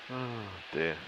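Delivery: usual speaking voice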